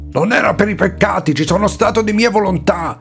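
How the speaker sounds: high, fast, irritated protest; indignant correction, pitched well up; exasperated retort. Acted. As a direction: snap back at a wrong assumption. Saint retorts, exasperated at friar.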